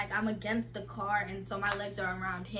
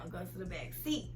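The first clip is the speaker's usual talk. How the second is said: imitating his voice, softer and lower; mild take on his low come-on voice; 4 semitones lower, 7 dB quieter than usual. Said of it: "He says to me in that voice".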